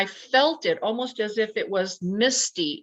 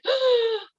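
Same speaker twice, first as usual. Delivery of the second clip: sharp high gasp of fright; imitated shocked gasp. All three staring at this thing, mock-horrified gasp. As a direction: mimic everyone gasping in shock. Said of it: "we're all kinda like".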